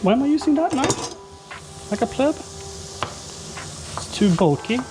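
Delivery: put-on high, slow Norwegian accent; fake Norwegian accent. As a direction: Norwegian accent creeping in, drawn out. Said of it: "my fake Norwegian accent comes out".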